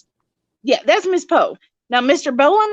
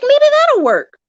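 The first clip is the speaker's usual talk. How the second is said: high-pitched, louder retold thought; hopeful idea; 14 semitones higher, 6 dB louder, slower than usual. Owner pouring sausage juice for a piglet, high hopeful voice. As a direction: act out a hopeful little brainwave. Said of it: "I was like".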